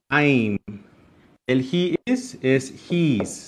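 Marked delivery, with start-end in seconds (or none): 0.1-0.6 "I'm" as one careful syllable; "I'm" said alone, as a single word; pronunciation demo. Shown as a demonstration. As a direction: model "I'm" clearly for learners. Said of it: "Se pronuncia así".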